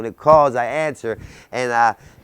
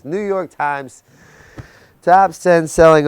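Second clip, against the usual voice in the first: slow and much louder on "top ten selling author"; proud emphasis; 6 semitones higher, 8 dB louder, slower than usual. Real, not acted. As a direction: land each word of "New York Times top ten selling author" like a headline. Listing his successes.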